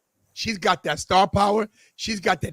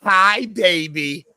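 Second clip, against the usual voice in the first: slow, drawn-out, loud mock greeting; imitating her greeting; 5 dB louder, much slower than usual. Man showing how she talks to him, drawn-out imitation. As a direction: stretch it out as a teasing imitation. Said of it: "this is how she sounds".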